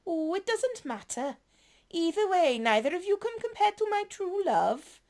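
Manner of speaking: lightly voiced flirty villain. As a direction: purr the compliments with a playful tease.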